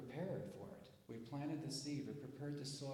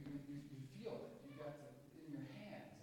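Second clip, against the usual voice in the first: normal talking voice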